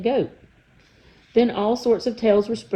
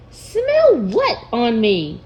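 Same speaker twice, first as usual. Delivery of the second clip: slow, bewildered question in a put-on voice; puzzled boy; 3 semitones higher, 5 dB louder, much slower than usual. The boy asking the trolls, slowly puzzled. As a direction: draw it out, confused.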